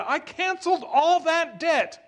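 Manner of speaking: king thundering in anger at his servant; angry king. Acted. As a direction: roar it at the wicked servant. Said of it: "In anger".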